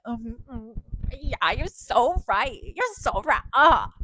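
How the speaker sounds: slow, fawning, overdone agreement; mock sucking up. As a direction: grovel in hammed-up agreement. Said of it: "suck her dick and be like".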